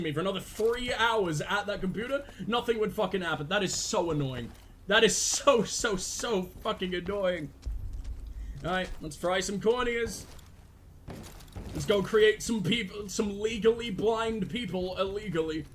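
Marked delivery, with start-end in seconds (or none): none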